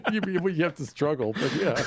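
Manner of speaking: stuttering through giggles; laughing. Real, not acted. Co-host laughing while defending the toddler claim.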